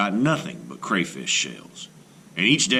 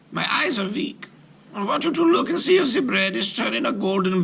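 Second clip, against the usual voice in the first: scheming witch; 13 semitones higher than usual. Acted. Witch luring Gretel to the oven, high and coaxing. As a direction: call her over like a crafty witch. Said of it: "the old woman called out to Gretel".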